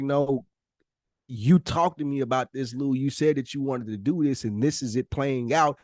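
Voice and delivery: usual speaking voice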